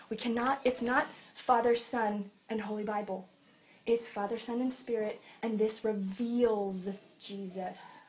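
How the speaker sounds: usual speaking voice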